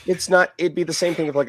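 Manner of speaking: ordinary delivery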